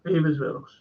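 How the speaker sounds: ordinary delivery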